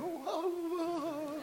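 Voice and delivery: imitated high, soft "oh"; flattered cooing. Preacher mimics a complimented woman sitting there, high soft flattered cooing. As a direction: coo it high and softly, mock flattered. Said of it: "she's sitting there going".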